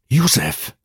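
high cry from a tight throat; choked out. A narrator's reading. Master grabbed by his servant, lightly choked cry. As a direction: force the name out through a tight throat. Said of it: "wykrztusił nareszcie ze ściśniętej gardzieli".

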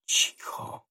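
unvoiced, frightened whisper; startled hush. A narrator's reading. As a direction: hush them in a soft whisper. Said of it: "odezwała się ... zadrżawszy".

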